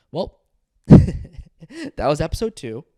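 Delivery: loud, slow words broken by a real laugh. Real, not acted. Closing his episode to viewers, laughing out loud. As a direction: laugh through the sign-off.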